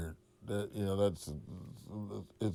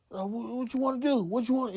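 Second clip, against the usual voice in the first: much higher, squeaky mock of a nervous rival; mocking Cat voice; 12 semitones higher than usual. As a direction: play the other guy as a jittery high squeak. Cat voiced in retold confrontation.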